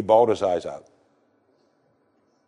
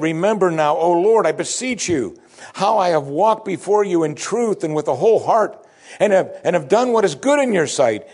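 pleading prayer; 6 semitones higher than usual. Acted. Preacher voicing Hezekiah's prayer.

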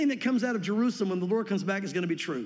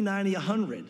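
ordinary talk

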